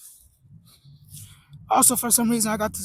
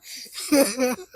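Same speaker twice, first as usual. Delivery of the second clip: nervous laugh; 3 semitones higher than usual. Real, not acted. Laughs while slipping on ice.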